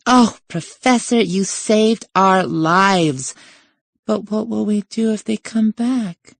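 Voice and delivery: high, hammed-up damsel gushing; pretended adoration. Girl fawning on the professor, feigned adoration. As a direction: gush to your rescuer in a put-on high voice. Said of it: "proceeding with the pretense".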